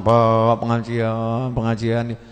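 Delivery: slow, drawn-out nagging; mock-whiny wife nagging her husband. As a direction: drag it out like a pestering wife.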